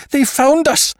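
high, loud terrified shriek; whistling cry. Acted. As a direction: shriek it out in panic. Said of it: "He gave a kind of whistling cry".

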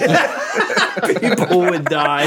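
high laughing voice. Real, not acted. Laugh heard.

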